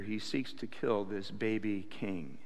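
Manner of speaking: ordinary talk, nothing marked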